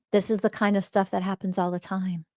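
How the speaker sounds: normal talking voice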